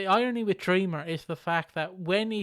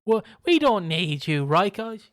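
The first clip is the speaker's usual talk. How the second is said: higher, louder goading jeer; mock taunting; 4 semitones higher, 6 dB louder than usual. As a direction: bait the big man into leaving. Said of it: "Elijah Burke's like".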